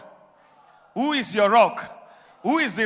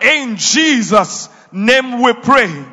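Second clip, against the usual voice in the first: loud proclamation; 2 semitones higher, 8 dB louder, slower than usual. Real, not acted. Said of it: "I say".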